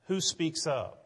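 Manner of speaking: usual speaking voice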